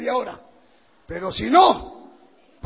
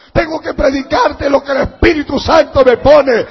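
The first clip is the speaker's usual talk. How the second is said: loud, rising declaration; full-force pulpit delivery; forceful preaching shout; 3 semitones higher, 11 dB louder than usual. Real, not acted. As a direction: thunder it out to the congregation. Preacher thunders at the congregation.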